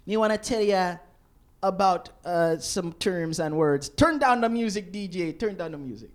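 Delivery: a hint of a Jamaican host's patois; lightly put-on Jamaican accent, calling to the DJ; mock Jamaican accent. Jamaican host addressing tourists in a light mock Jamaican accent. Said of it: "he was like".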